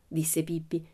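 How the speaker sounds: ordinary delivery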